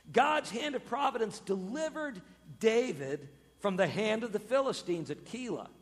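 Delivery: usual speaking voice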